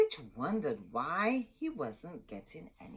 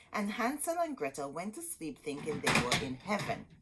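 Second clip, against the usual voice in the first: ordinary delivery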